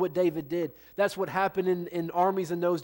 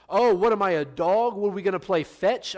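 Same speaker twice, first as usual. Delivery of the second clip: mocking Goliath; 3 semitones higher, 6 dB louder than usual. Preacher voices mocking Goliath.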